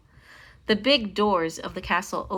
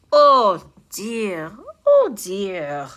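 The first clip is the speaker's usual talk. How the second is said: weary dismay; 7 dB louder, much slower than usual. A narrator's reading. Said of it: "the tired professor thought".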